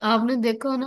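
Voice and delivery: usual speaking voice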